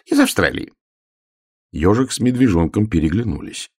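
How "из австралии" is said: very high airy boast; showily casual. Acted. Hare boasts of where his dog came from, casually. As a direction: toss it off high up. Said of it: "небрежно сказал заяц".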